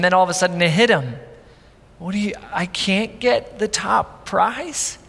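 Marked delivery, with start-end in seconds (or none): 2.0-5.0 hurt disbelief. Retold or imitated. Boy realizing he can't.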